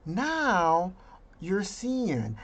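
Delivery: ordinary talk, nothing marked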